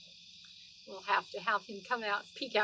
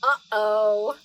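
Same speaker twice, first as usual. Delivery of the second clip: uh-oh; 12 dB louder than usual. Acted. Cat appears in the story.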